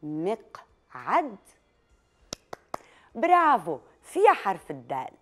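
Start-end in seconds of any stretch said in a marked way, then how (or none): 0.0-1.4 drawn out very slowly with a questioning rise. Shown as a demonstration. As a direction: stretch the word slowly, then lift at the end. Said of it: "سمعتوا الد بكلمة".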